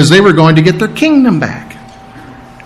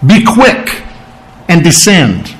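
Be quick pitched up, descend plain; two commands; 2 semitones higher, slower than usual. Acted. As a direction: snap out Be quick, then descend. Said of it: "There's two commands here".

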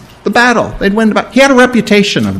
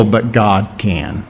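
low, soft, slow delivery; hushed emphasis; 8 semitones lower, 8 dB quieter, slower than usual. Acted. Preacher quietly stresses that God can curse them. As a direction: drop the voice to land the point. Sermon point about God.

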